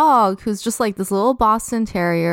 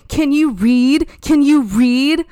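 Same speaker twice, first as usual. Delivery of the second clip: mock taunt; 6 semitones higher, 5 dB louder than usual. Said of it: "she kept saying".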